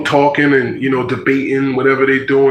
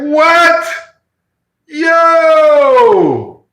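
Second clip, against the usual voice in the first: much higher, loud stunned shout; shocked yell; 6 dB louder than usual. Retold or imitated. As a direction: yell out in disbelief at the preacher. Shocked to see the imam.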